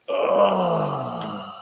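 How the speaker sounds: low effortful groan. Real, not acted. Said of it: "and make old man noises".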